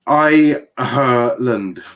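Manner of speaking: "Ireland" stretched very slowly in separate syllables; exaggerated practice. Shown as a demonstration. Streamer practises Ireland for chat, exaggeratedly slow. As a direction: draw "Ireland" right out, syllable by syllable. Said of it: "me just saying Ireland".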